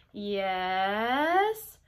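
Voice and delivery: "Yes" stretched long; drawn-out yes. Shown as a demonstration. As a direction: drag out "Yes" as the kids' reply. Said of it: "draw out the word yes".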